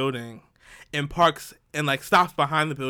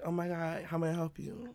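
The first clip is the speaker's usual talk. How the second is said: soft, breathy-sweet innocent hostess voice, much softer; innocent flirt voice; 10 dB quieter than usual. Retold or imitated. Her innocent flirt voice greeting the man. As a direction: sound dainty and helpful. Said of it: "like, innocent".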